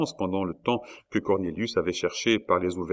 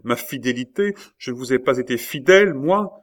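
raised, indignant questioning, building to a high last word; tearful protest; 5 semitones higher, 6 dB louder than usual. A narrator's reading. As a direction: protest as if deeply hurt, without real sobs. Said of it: "s'écria Rosa tout en larmes". (protestation blessée, la voix qui monte)

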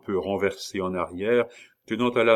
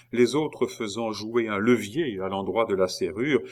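ordinary delivery

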